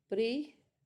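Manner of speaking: "present" broken into pree ... zent; syllables split apart. Shown as a demonstration. Teacher showing the verb form, "present" split as pree, then zent. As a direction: rise on "pre", long pause, then "zent". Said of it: "is on zent".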